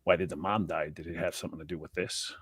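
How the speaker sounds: ordinary talk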